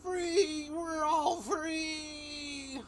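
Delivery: high, drawn-out shout of freedom; crazed inmate cheer. Acted. Freed inmate shouting to the others, high and stretched. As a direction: yell it like a madman let loose.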